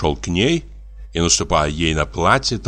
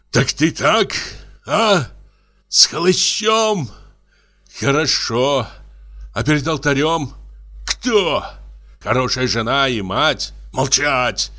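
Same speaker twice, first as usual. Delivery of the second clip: angry; 5 semitones higher than usual. A narrator's reading. Husband berates wife.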